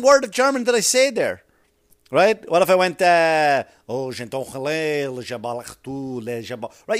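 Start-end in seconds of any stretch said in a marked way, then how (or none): 3.9-6.7 soft, fading mock French gibberish; fake French gibberish. Comic faking French for the audience. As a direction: murmur made-up French words.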